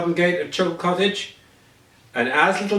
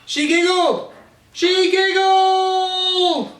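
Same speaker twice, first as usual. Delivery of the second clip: much higher, building to a slow long shout of joy; joyous cry; 3 dB louder, much slower than usual. A narrator's reading. The narrator celebrates her giggle returning, joyous shout. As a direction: crow it out with delight. Joyful climax of story.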